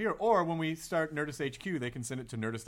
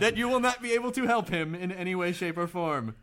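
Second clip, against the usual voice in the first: high, loud formal proclamation; mock grave announcement; 5 semitones higher, 6 dB louder than usual. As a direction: pronounce the verdict with hammed-up finality. Mock answer to the emailer's plea.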